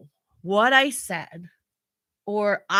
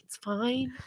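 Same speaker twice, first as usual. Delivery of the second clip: very soft, gentle reassurance; soothing; 9 dB quieter than usual. Retold or imitated. Husband telling upset wife she's tired, soothing. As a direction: imitate a calming husband quietly.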